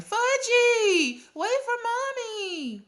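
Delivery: put-on high, shrieked call after a toddler; hollering mother. A narrator's reading. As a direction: holler after a runaway child, very high and drawn out.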